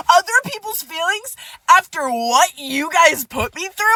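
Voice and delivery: high, indignant echo of the words; offended, high-pitched disbelief; incredulous scoff. Real, not acted. Scoffing at friend's message.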